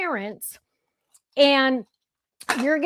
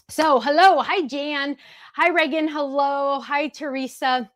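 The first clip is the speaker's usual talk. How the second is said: usual speaking voice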